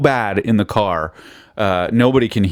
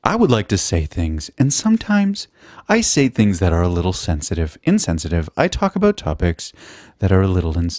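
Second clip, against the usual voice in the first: infomercial presenter with pitch leaping up on openers; mock infomercial. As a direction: deliver it like an earnest infomercial disclaimer. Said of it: "in, like, an infomercial?".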